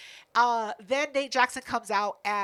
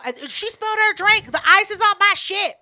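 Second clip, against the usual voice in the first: high, loud outraged protest; indignant complaint; 8 semitones higher, 8 dB louder than usual. Retold or imitated. Complaining to security about the spilled drink, indignant. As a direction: blurt it out, aggrieved and loud.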